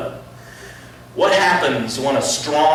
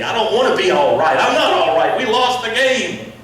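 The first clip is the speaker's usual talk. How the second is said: loud, much higher, fast outburst; furious athlete; 9 semitones higher, 5 dB louder than usual. Retold or imitated. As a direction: refuse comfort after the loss. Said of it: "She's like".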